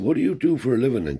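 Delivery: old man grilling a guest, voiced high and loud; grandfather's voice.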